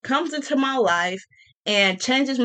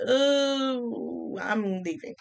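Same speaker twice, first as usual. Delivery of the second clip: soft, laughing ooh I'm leaving; mock leaving; 7 dB quieter, much slower than usual. Brushing off a boring date, softly laughing. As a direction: giggle your way out. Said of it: "and it's just like".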